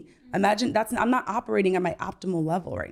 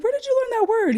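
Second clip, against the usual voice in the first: much higher, amused disbelief; astonished mom; faster than usual. Retold or imitated. Mom shocked by her son's new word, high and amused. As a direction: squeak it out like a stunned parent. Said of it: "I was like".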